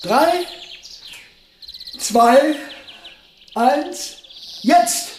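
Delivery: each number lifted high, pushing toward the go; eager countdown. Acted. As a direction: count it down like a starter's pistol is waiting. (aufgeregter Countdown, jede Zahl hoch)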